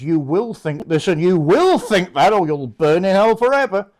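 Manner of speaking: loud hellfire decree, hammering each command; mock doctrinal threat. Church laying down doctrines to its flock, mock threatening shout. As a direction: thunder it out like a domineering church.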